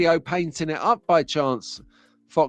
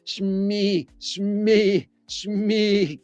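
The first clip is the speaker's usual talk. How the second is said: strained, higher stuck attempts at a word; Crichton; 5 semitones higher, much slower than usual. Robot programmed not to swear, straining and failing on the word. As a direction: stall on one stretched syllable, unable to finish it. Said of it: "Crichton ... trying to say the word smeg".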